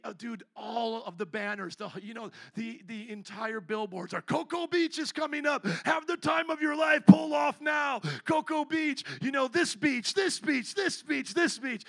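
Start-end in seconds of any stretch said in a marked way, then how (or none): 4.2-11.8 loud, high-pitched sales call; excited billboard shouting. Acted. Acting out beach billboards along the highway, excited shouting.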